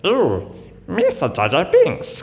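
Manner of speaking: channel a clumsy cartoon alien; silly creature voice from Star Wars; Jar Jar Binks voice. Shown as a demonstration. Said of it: "kind of thing".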